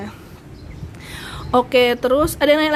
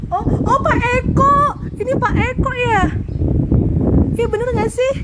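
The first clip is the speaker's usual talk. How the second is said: surprised recognition; 10 semitones higher than usual. Real, not acted. Recognizing viewer Pak Eko in the live chat, surprised and delighted.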